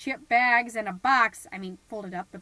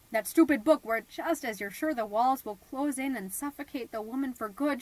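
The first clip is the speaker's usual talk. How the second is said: usual speaking voice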